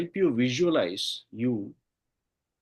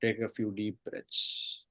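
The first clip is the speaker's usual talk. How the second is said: slow, softer deep-breath cue; soft spa whisper; 4 semitones lower, 8 dB quieter than usual. Acted. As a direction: draw the words out, hushed. Repeat in meditation.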